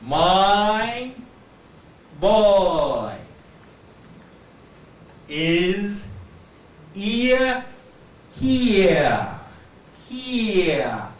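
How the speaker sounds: put-on each word of my boy is here stretched and separate; combined diphthongs. Shown as a demonstration. Teacher combining diphthongs, each drawn out. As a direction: glide through the vowels of my, boy, ear, here. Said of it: "to combine them".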